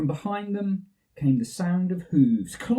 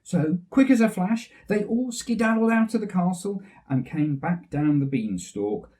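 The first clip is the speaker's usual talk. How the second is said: ordinary delivery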